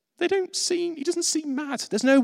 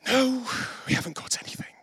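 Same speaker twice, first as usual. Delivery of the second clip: restrained irritation; 8 semitones higher, 5 dB quieter than usual. Retold or imitated. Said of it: "how the disciples say the word".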